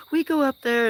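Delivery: put-on high, loud child voice; excited kid. Kid telling him where they play, in a loud high child voice. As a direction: shout it like a proud little kid. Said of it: "They're like".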